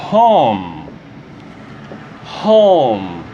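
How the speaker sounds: Home with a breathy aspirated H; English pronunciation. Shown as a demonstration. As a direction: push air out on the H of Home. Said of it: "Esse é o som do H". (Home com H aspirado)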